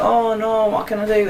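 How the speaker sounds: high-pitched mock fretting; mock panic. Character facing monsters attacking everywhere, mock panic. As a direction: put on a high, helpless wail.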